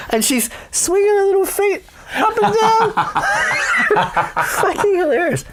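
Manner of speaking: laughing while telling it. Real, not acted. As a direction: giggle through the words. Laughing through the story.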